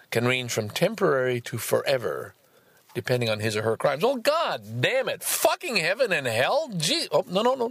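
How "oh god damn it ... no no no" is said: exasperated outburst. Real, not acted. Reacting to a passage about heaven, high exasperated cries. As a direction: throw up your hands at what you just read. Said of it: "I'm supposed to be a little open-minded here".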